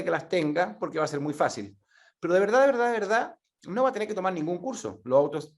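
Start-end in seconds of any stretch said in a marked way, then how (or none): 2.2-3.4 each verdad pushed harder and higher; insistent emphasis. Real, not acted. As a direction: hammer home de verdad, de verdad, de verdad. Emphatic triple repetition.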